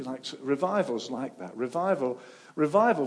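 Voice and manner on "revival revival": rising, louder revival cry; emphatic call. Real, not acted. Preacher calling out revival, louder each time.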